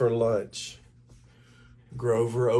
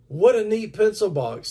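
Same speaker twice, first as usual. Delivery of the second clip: put-on higher, louder excited boy; eager kid; 9 semitones higher, 6 dB louder than usual. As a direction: gush over the new box. Bill admires pencil box.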